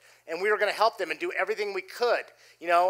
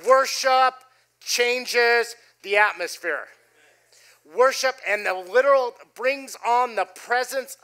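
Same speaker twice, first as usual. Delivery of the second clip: louder, slow, every word weighted; preaching emphasis; 4 semitones higher, 5 dB louder, slower than usual. Acted. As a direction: proclaim it to the church. Preacher's key line to congregation.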